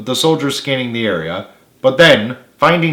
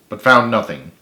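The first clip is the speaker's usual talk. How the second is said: plain reading voice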